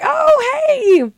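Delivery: very high, drawn-out hello; squeaky polite hello; retold fake-friendly greeting. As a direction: sing out a high, put-on greeting.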